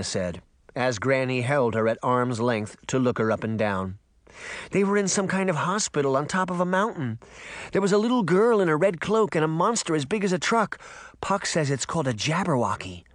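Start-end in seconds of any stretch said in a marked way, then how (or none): 4.7-13.0 put-on higher-pitched, urgent young girl; breathless girl reporting. A narrator's reading. Girl tells Granny she saw her parents, urgent. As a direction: blurt out the news in a higher girlish voice.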